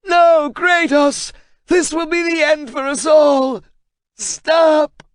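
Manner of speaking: very high, loud, slow pleading scream; desperate shout. Acted. Translator pleading with Kratos, desperate shout. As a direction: beg him to stop with everything.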